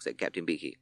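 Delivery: ordinary delivery, nothing marked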